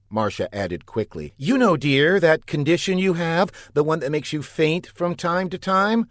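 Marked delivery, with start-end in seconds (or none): 1.4-6.1 sweet wife voice. A narrator's reading. Marcia's sweet pretend-wife voice.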